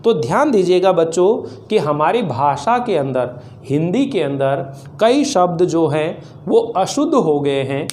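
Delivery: ordinary talk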